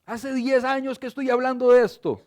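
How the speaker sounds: high, loud exasperated shout; fed-up person yelling at someone who won't listen; angry outburst. Acted. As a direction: slam the table and demand attention. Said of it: "pega un manotazo en la mesa y dice".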